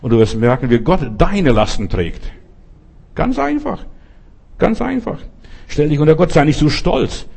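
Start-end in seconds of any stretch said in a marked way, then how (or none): 3.2-3.8 much higher, soft and light; high light aside. Real, not acted. Preacher stresses simplicity. 4.6-5.2 high light aside. Real, not acted.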